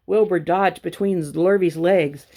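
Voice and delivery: plain reading voice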